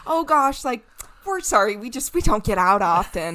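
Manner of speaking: put-on high, loud apologetic Wisconsin accent. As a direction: play an overfriendly Midwesterner in a Wisconsin accent. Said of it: "a Wisconsin accent for some reason".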